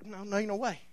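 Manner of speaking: ordinary talk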